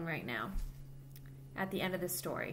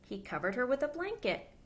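put-on lively storybook voice for little kids; reading to children; 3 semitones higher than usual. A narrator's reading. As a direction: read it aloud like storytime for children. Reads picture book to children.

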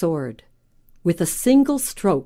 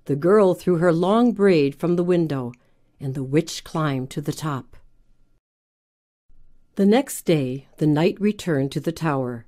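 ordinary delivery

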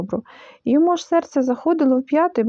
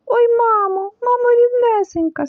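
frightened wail; 10 semitones higher than usual. A narrator's reading. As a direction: wail it in fright, stretching the words. Frightened Piglet at the pit, read aloud. (переляканий зойк)

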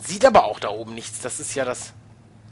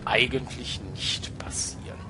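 whispered; 3 semitones lower, 8 dB quieter than usual. Real, not acted. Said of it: "Mit Betonung auf eigentlich".

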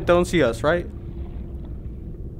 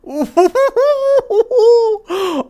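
delighted laugh; 5 dB louder than usual. Real, not acted. Delighted at the creatures.

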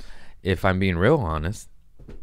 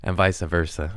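usual speaking voice

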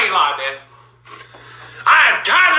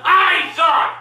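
angry yelled refusal; real furious defiance; defiant shout. Real, not acted. Refusing to apologize, yelling.

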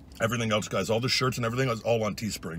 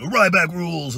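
wrestler catchphrase; 8 semitones higher, 8 dB louder, slower than usual. Retold or imitated. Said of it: "I would go".

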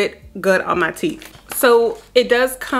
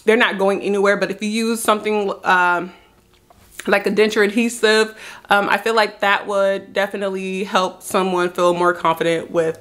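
slight lisp from tooth covers; talking over fake teeth; speaking with veneers in. Real, not acted.

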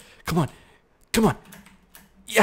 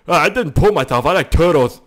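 mock player voice; 4 semitones higher, 8 dB louder than usual. Voicing a player who pooped himself.

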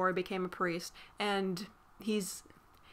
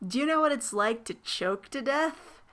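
put-on eerie, higher child's voice; creepy little girl voice; 5 semitones higher than usual. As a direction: ask it like a creepy possessed kid. Creepy girl describing choking.

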